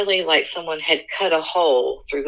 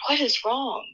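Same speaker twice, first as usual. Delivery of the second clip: husband's alarmed question; 11 semitones higher than usual. Retold or imitated. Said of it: "my husband was like".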